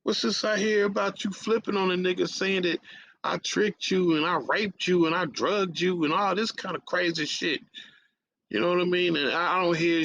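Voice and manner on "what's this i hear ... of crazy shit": 1 confronting on phone. Retold or imitated. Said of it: "I'm like".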